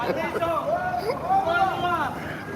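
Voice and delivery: soft high giggle. Real, not acted. Laughs at the scene.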